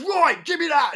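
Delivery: very high mock-furious voice; imitated angry outburst. Driver out of the van demands the photo, mock furious. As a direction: burst out high, mimicking a man losing it. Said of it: "the angry guy".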